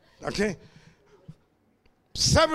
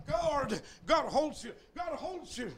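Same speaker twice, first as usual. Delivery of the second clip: soft reassurance; 3 semitones higher, 10 dB quieter than usual. Acted.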